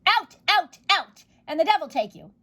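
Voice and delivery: high, loud furious bellow. Acted. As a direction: throw each out like a shove. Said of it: "he bellowed".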